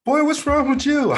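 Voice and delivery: high, loud mock dad yelling boy; hammed-up dad shouting at his son; stern dad. Dad voiced in retold story.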